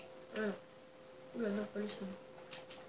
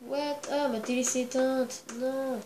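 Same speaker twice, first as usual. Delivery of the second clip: sudden alarmed outburst, a bit louder; startled dismay; 2 semitones higher, 7 dB louder, slower than usual. Real, not acted. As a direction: caught off guard as the TV dies. TV suddenly switches off mid-game. (surpris et affolé, un peu plus fort)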